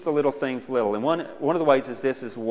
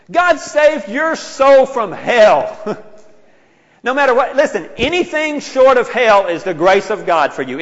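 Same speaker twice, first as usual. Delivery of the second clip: high, loud, fervent preaching; booming revival preacher; preaching at full force; 7 semitones higher, 8 dB louder, slower than usual. Acted. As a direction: drive it home to the congregation.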